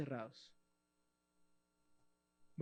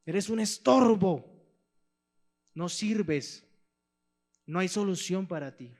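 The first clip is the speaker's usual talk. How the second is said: loud, contemptuous shouting down; crowd yelling; 3 semitones higher, 8 dB louder, slower than usual. Acted. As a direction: silence him with scorn. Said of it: "empieza a gritar".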